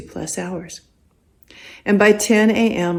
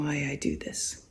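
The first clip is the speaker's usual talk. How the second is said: confiding whisper; 4 semitones lower, 13 dB quieter than usual. Real, not acted. Confiding a selfish reason.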